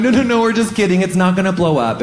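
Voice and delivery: high-pitched, hurried backtracking; hasty reassurance. Retold or imitated. Rehab staff imitated calming a sobbing patient, high hasty reassurance.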